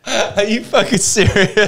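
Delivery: incredulous, laughing through the words; laughing disbelief. Real, not acted. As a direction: laugh it out, slow and high.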